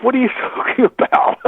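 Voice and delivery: imitating disbelief, breaking into laughter; incredulous laughing. Radio host laughing at him for not telling, high pitched. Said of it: "he's going".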